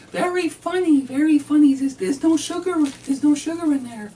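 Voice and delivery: high, loud sarcastic wife voice; mimicking his wife. Husband voices his exasperated wife at the table, high and loud. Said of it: "she says".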